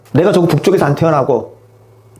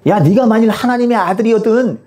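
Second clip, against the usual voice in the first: higher, taunting challenge; mocking jeer; 6 semitones higher, 3 dB louder than usual. Crowd mocking Jesus on the cross, jeering. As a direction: jeer at him as a crowd would. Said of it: "사람들이 예수님을 조롱하며 뭐라고 그러죠?".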